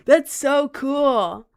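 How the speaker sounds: laughing swoon; slow high gush. Real, not acted. Streamer swooning to chat about a locked grimoire. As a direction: stretch it high and loud.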